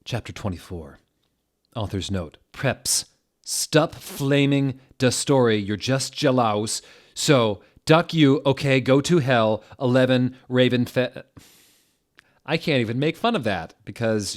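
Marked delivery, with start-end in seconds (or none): 2.5-11.2 higher, louder, hammed-up angry rant; mock-furious author. The teen author yelling at her critics, in a hammed-up furious voice.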